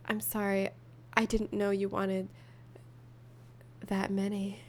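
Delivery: hushed drama, read like an audiobook; sultry dramatic reading. Acted. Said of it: "add a little drama to it".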